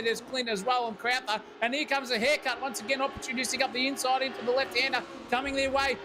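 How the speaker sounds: fast, rising play-by-play; excited race commentary. Acted. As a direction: call the overtakes with building excitement.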